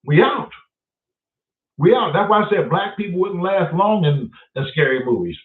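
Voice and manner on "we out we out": we out. Acted.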